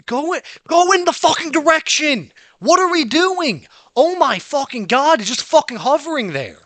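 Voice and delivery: high, loud furious yelling at the game. Real, not acted. Gamer yelling at the hovering object, real furious shout. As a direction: scream in real rage at the broken controls.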